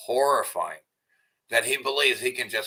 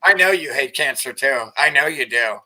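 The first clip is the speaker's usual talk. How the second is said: sneering and raised, louder taunt; 5 semitones higher, 5 dB louder than usual. Real, not acted. Streamer taunting the man on screen, sneering. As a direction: needle him like a bully.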